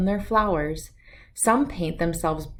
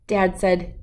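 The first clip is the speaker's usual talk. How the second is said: ordinary delivery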